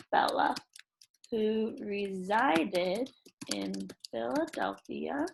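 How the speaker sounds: teacher typing a story aloud for her class, slow soft dictation; dictating while typing. Acted. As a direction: read each word out slowly as you type it.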